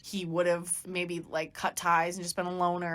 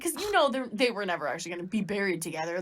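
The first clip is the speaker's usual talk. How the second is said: ordinary talk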